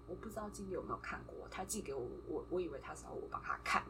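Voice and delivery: quick low murmur, almost a whisper; hushed retold reply. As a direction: mutter it fast and hushed. Retells own quiet reply. (轻声快速地复述自己的回答)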